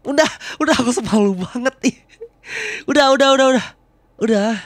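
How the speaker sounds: loud, high giggly protest with a laugh. Acted. Girlfriend squealing at her partner to stop teasing, laughing and loud.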